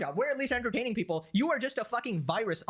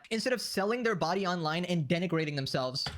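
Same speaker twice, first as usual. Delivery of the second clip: usual speaking voice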